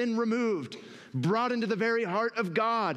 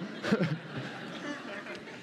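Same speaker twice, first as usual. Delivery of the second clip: laugh; 7 dB quieter than usual. Real, not acted. Laughs after the soul voice.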